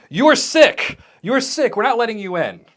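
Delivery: high, loud shout through the glass. Acted. Accountant to sick coworkers through glass, shouting. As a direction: shout them away in alarm. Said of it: "they're shouting".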